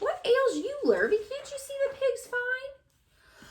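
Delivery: put-on very high exasperated old farmer voice; drenched exclamation. Drenched farmer scolds Lurvy, high exasperated squawk.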